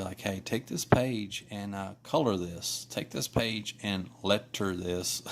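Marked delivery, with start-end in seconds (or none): none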